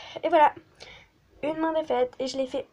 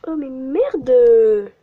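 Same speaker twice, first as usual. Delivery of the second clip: much louder, drawn out; exasperated shout; 13 dB louder, much slower than usual. Real, not acted. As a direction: yell it out slowly. Loud slow outburst. (cri exaspéré)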